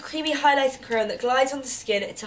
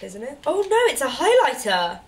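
ordinary talk

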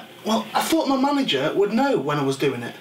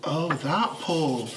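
slow dawning realisation; 3 semitones lower, much slower than usual. Real, not acted. Embarrassed realisation.

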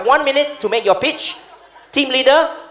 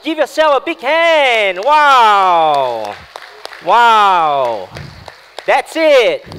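loud, drawn-out crowd-rousing celebration; big hype cheer; 3 semitones higher, 6 dB louder, much slower than usual. Real, not acted. Coach cheering teams as time runs out, loud hype. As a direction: call out high and slow, stretching the wows. Facilitator ends session, calls for applause.

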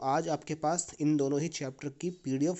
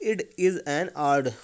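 "It is an art" slow in a German accent, with "art" ending on D; German accent demo; 4 dB louder, much slower than usual. Shown as a demonstration. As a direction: demonstrate the German accent slowly, T turned to D. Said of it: "आप ध्यान से देखेंगे".

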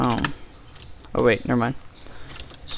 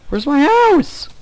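surprised; 13 semitones higher, 7 dB louder, slower than usual. Real, not acted. Lost house after jumping.

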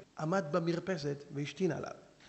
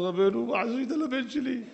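slow, higher pleading with an Arabic lilt; pleading sheikh; 7 semitones higher, 4 dB louder, slower than usual. Acted. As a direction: beg the rabbi for his son.

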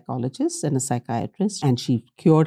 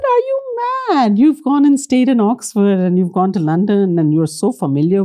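much higher, slowed, mocking disbelief; incredulous husband; 6 semitones higher, 5 dB louder than usual. Husband scoffing at his wife's fear of London bombs, incredulous. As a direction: scoff at her like she's lost it.

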